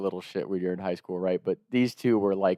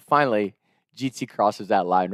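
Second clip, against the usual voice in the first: ordinary delivery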